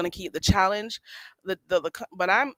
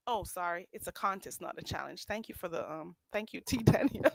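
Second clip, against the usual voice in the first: much softer muttered aside; quiet aside; 4 semitones lower, 13 dB quieter than usual. Real, not acted. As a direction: read the comment under breath. Aside reading comment.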